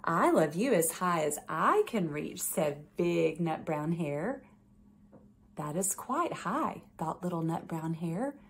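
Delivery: gentle bedtime story read-aloud; reading to children. A narrator's reading. Judge reading a picture book to children, gentle storytelling.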